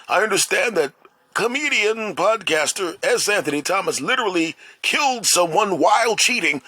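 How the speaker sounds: high-pitched, singling out the culprit; mock scandal commentator. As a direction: put on a high, scandalized announcer voice. Imagined future report exposing him.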